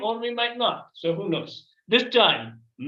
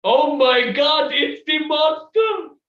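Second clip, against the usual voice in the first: scared shout; 10 semitones higher, 8 dB louder, slower than usual. Acted. Monster appears at campfire.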